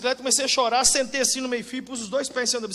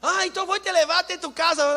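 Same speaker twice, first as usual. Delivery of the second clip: Carlos offering a ride; 8 semitones higher, 6 dB louder than usual. Retold or imitated. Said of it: "Eu falei".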